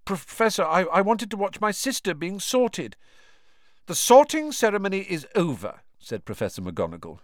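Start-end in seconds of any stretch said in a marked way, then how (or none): 0.1-3.0 put-on nervous boy. A narrator's reading. Ron voiced, higher pitch. 3.8-5.8 put-on stern old professor. A narrator's reading. McGonagall voiced.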